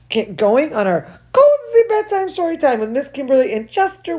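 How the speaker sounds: plain reading voice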